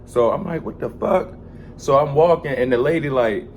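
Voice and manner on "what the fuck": high, puzzled mock reaction; retold disbelief, voiced higher; baffled what the fuck. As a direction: act out the confusion, pitched up. Confused reaction quote in story.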